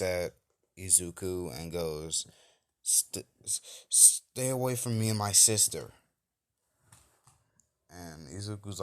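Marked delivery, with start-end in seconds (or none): 2.8-5.9 put-on frightened kid stammering, then louder; stutter, then blurt it out to keep him back; scared stammer. Kota shielding his sister from Izuku, scared stammer. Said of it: "he is afraid".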